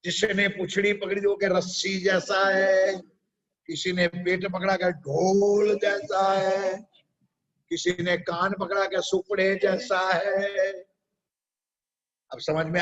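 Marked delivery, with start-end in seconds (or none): 1.5-3.0 slow, stretched-out storyteller quote; long, lingering final syllables; blind man's verdict. Retold or imitated. 4.9-6.7 slow, stretched-out storyteller quote; long, lingering final syllables; blind man's verdict. Retold or imitated. 9.0-10.7 slow, stretched-out storyteller quote; long, lingering final syllables; blind man's verdict. Retold or imitated.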